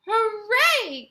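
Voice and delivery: high, loud cheer; joyful cry. A narrator's reading. Wonka cheers that the boy arrived whole, high and loud. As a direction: cheer in triumph. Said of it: "cried Mister Wonka".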